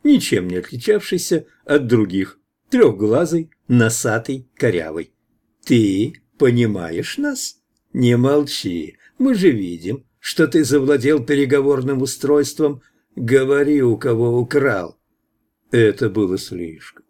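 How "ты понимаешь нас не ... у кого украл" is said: ordinary delivery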